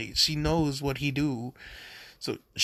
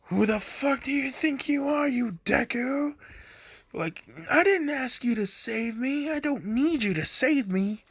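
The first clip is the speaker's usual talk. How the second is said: high-pitched mock tirade; hammed-up angry Bakugo; mock angry yell; 11 semitones higher than usual. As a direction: tell him off in a higher voice. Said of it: "She just goes off".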